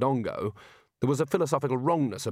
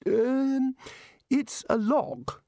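high, slow wizard; Ridcully's voice; 14 semitones higher, much slower than usual. Acted. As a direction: point out the obvious. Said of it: "said Rid Cully".

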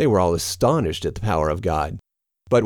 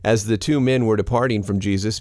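usual speaking voice

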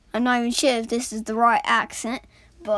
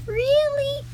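much higher, small disappointed voice; a tiny coal let down; squeaky coal voice. Acted. Ignored coal asks in a high small voice.

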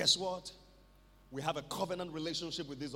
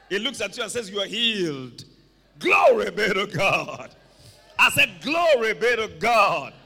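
full-force preaching; 14 dB louder than usual. Real, not acted. Preacher declaring healing to the congregation, full-force preaching. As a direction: build to a booming declaration.

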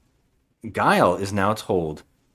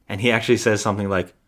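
ordinary talk, nothing marked

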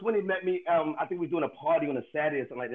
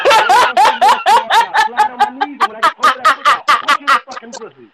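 loud laughing mock threat; mock threat shout; 11 semitones higher, 15 dB louder, slower than usual. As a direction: yell it as a joking threat, cracking up.

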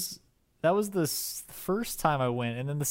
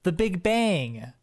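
slow deliberate answer; 5 semitones higher, 3 dB louder, much slower than usual. Retold or imitated. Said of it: "raised my hand and said".

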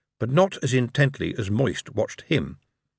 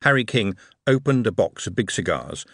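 ordinary talk

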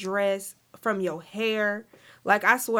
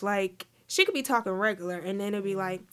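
ordinary talk, nothing marked

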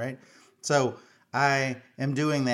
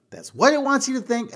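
much higher, louder repeat; panicky outburst; 13 semitones higher, 7 dB louder than usual. Retold or imitated. Dad warns daughter the spider is tricking her, panicky outburst. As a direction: burst out in mock-paranoid alarm.

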